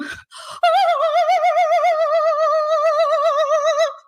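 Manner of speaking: high cackling burst of laughter; loud laughter. Real, not acted. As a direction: howl with laughter.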